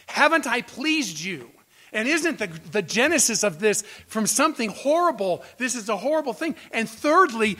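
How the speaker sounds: preacher half-acts the king's plea; voicing the king. Preacher voicing the king defending himself to Esther. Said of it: "The king is basically saying".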